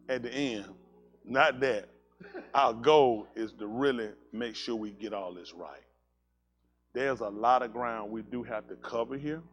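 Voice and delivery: quiet sincere sermon; earnest sermon. Acted. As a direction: lay it out gently to the congregation. Preacher turns to teaching.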